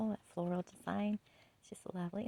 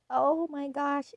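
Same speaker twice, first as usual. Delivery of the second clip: slow, drawn-out, louder oh my gosh; gushing; 6 semitones higher, 8 dB louder, slower than usual. Real, not acted. Vlogger gushing to viewers about decor, slow and loud. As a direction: let the delight spill out slowly.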